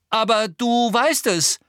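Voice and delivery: high, loud, slow insistence; shouted accusation. A narrator's reading. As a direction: shout it at the elf. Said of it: "rief Harry".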